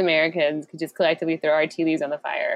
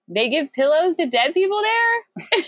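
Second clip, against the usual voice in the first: incredulous; 11 semitones higher than usual. Real, not acted. Incredulous question.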